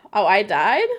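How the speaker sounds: loud surprised "I died?". Real, not acted. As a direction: blurt it out, caught off guard and loud. Sudden death in the game.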